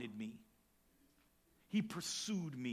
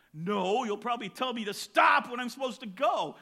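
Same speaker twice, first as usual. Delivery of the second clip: louder, indignant jab at the counselor; mock grumpy retort; 6 semitones higher, 8 dB louder than usual. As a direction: throw the old grudge back, half joking. Retorts to counselor about baseball.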